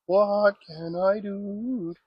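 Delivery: stretched-out, pondering what; slow drawn-out question. Real, not acted. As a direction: drag out each word, puzzled. Repeated, drawn out slowly.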